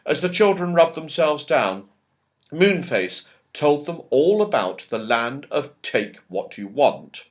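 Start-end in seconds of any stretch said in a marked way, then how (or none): none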